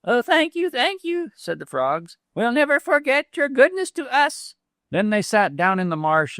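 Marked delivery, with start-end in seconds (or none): 0.1-4.6 higher, mildly voiced thankful frogs; grateful frogs. Frogs thanking the weasel, higher grateful voices.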